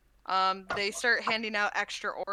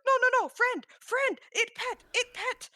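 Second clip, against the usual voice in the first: frantic dwarf; 7 dB louder than usual. Acted. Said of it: "Krell's like".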